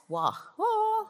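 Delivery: high, drawn-out "wah, wah"; mock sad trombone. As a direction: sing out a comic sad-trombone noise. Mock sad trombone after obvious definition.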